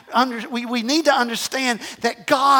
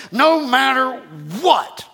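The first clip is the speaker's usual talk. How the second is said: "No matter what" stretched, with a punched WHAT; slow, emphatic "No matter what"; emphatic exclamation; slower than usual. Shown as a demonstration. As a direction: put an exclamation point on WHAT. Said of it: "got an exclamation point at the end".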